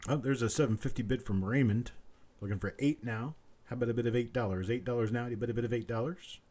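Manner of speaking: rapid auctioneer patter, looping the price; auctioneer bid calling. Acted. Auctioneer calling for bids from stream viewers, quick rolling patter. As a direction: rattle off the bid call like a sale barn auctioneer.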